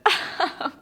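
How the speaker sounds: high, airy laugh into the words; breathy laugh. Real, not acted. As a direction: giggle helplessly. Laughing while emotional.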